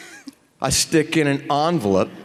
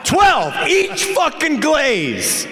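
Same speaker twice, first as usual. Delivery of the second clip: excited laughing punchline; 12 semitones higher, slower than usual. Real, not acted. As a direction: blurt out the punchline, cracking up. Punchline of naked Polaroid story.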